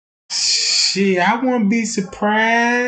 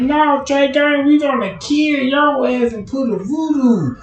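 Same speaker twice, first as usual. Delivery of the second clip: higher and louder, hammed-up threat; mock angry fans; 7 semitones higher, 5 dB louder than usual. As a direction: shout it back at him like an offended fan.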